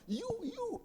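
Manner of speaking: high-pitched affected slang; mock village youth. Teacher asking how villagers talk, high mimicking voice. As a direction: put on a showy villager's English. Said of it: "Di desa tapi ngomongnya apa?".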